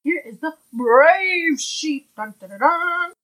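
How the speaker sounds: bold heroic voice. Acted. Reading the brave sheep page.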